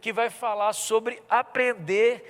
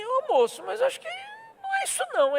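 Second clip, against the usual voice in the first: much higher, near-crying disbelief; incredulous. Retold or imitated. Pastor retelling how he protested to the stranger, high-pitched whine. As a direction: squeak out the protest.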